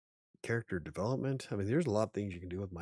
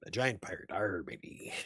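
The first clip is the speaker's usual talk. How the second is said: a quick gruff-free pirate growl, lightly done; a hint of a pirate. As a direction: toss off a playful pirate "arr". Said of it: "I can do my pirate voice".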